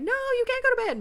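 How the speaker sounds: high loud insistent shout; pleading shout. Retold or imitated. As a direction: yell to stop him going to bed. Arguing with husband.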